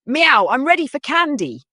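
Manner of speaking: put-on high, loud meowing kid; excited cat voice. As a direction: announce it like an eager cat. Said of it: "Jack announced".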